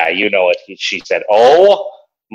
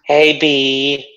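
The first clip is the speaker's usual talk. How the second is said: mock sweet greeting; 6 semitones higher than usual. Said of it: "remember what she said".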